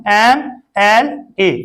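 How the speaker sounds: very slow, each letter stretched: MLA; slow spelling out. Shown as a demonstration. Teacher spells abbreviation for children, stretched slowly. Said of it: "drawn out for children".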